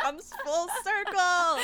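high, slow and laughing. Real, not acted. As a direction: crack up while saying it. Both laughing hard.

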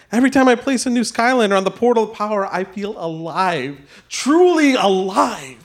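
rising, slowing rapture; ecstatic. Acted. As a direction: savour the feeling. Villain's ecstatic confession.

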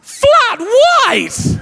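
very high, loud squawk of disbelief; incredulous drive-through voice. Retold or imitated. Drive-through worker querying the second order, incredulous. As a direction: shriek it back as an outraged question. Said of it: "to the metallic voice".

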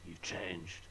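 a light, quiet mock Scottish accent; weird Scottish accent. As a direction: toss off a half-hearted Scottish accent. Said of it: "like, weird Scottish accent".

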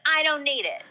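exaggerated Queens mother, flatly refusing; mock New York mom. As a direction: mimic a dismissive mom in a thick Queens accent. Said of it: "you think she sounds like that".

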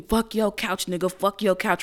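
ordinary delivery

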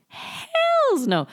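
high squeaky "hells no"; emphatic refusal. Real, not acted. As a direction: scoff it way up high. Mock outrage at low bar.

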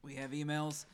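hiccuping through the words; hiccup giggles. Real, not acted. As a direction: talk between hiccups and burps. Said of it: "I'm hiccuping and burping".

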